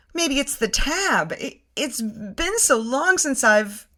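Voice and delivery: trailing off, woozy; fading words, a touch faint; dizzy. Retold or imitated. Narrator explaining herself before blacking out, dizzy. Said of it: "I say, dizzy".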